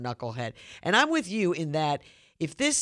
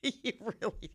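laughing; 6 semitones higher, 9 dB quieter than usual. Real, not acted. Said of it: "I'm not trying to laugh".

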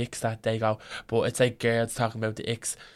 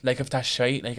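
ordinary talk

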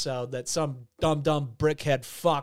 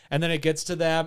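ordinary delivery

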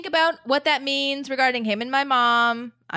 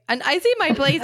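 usual speaking voice

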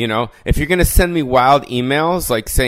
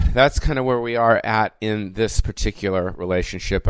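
ordinary talk

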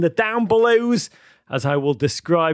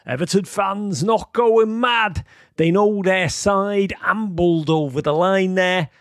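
drawn-out commentator's call, raised pitch; radio commentator; 4 semitones higher, slower than usual. Retold or imitated. Radio commentator quoted at the final whistle, slow mock commentary. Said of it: "Even he said at the final whistle".

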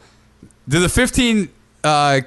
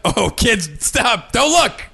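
alarmed parent; 6 semitones higher, slower than usual. Retold or imitated. Parent shielding kids.

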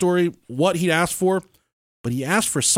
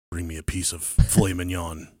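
lower, blunt mock creature order; lower, lightly voiced reptilian boyfriend ordering; deep alien voice; 10 semitones lower, 4 dB quieter than usual. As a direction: drop the voice like a strange beast.

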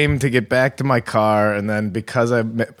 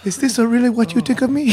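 Bruce Lee; 12 semitones higher, faster than usual. Retold or imitated. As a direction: play concerned in a caricatured accent. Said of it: "he was just really concerned".

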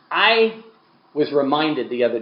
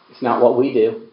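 ordinary delivery

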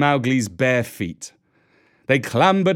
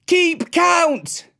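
high, ringing, scornful yell; scornful shout; 3 dB louder than usual. A narrator's reading. Mowgli taunts the villagers, shouting scornfully. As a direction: shout it with contempt. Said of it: "shouted Mowgli scornfully".

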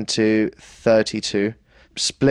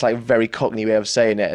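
usual speaking voice

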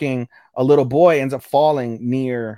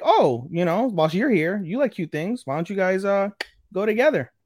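high quoted voice; 8 semitones higher than usual. Retold or imitated. Fuyusuki voiced in retold scene.

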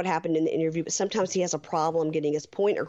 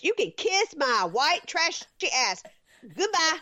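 much higher, fierce mock tirade; Angela ranting; 11 semitones higher, slower than usual. Host reading Angela's rant at Michael's friends, high and fierce. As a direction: channel Angela telling off the goofballs. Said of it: "she goes".

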